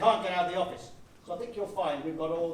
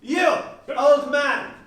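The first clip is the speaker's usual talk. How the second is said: louder and higher, slowly spelled out; loud slow command; 6 semitones higher, 8 dB louder, slower than usual. Acted. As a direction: order the old man sternly. Enemy orders an old man.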